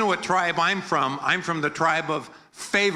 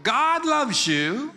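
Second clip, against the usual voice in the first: slow, drawn out; 8 semitones higher, much slower than usual. Shown as a demonstration. Line for congregation to repeat.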